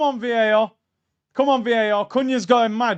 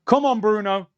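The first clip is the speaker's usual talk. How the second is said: ordinary delivery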